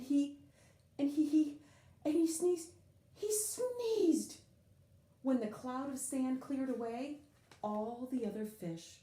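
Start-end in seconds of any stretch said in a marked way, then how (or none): 0.0-4.4 building sneeze. A narrator's reading.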